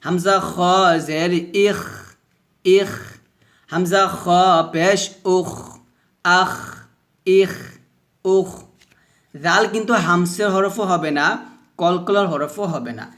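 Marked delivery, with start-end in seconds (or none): 0.0-8.5 thick airy kh ending আখ, ইখ, উখ; slow spelling, long rasped kh in আখ, ইখ, উখ; heavy hams drill. Shown as a demonstration. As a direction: let the back-of-throat scrape run on. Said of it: "এভাবে".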